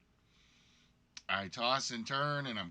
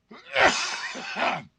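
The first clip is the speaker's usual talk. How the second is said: harsh loud coughing fit; loud hacking cough; 8 dB louder than usual. Real, not acted. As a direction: hack hard, chest congested. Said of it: "you hear what I'm still suffering through".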